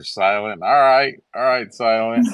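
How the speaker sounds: loud, high playful teasing. Pretend. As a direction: rib him good-naturedly. Teasing the host.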